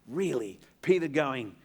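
ordinary delivery

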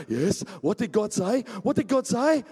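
gasped, rising, loud questions; eager crowd. Acted. Gathered crowd asks Moses for news, eager and excited.

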